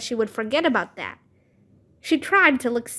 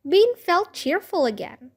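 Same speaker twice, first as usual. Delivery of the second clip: ordinary delivery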